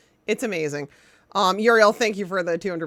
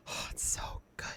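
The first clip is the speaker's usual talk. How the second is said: breathy whispered aside; 16 dB quieter than usual. Real, not acted. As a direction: whisper it like a secret. Gushing about favorite game.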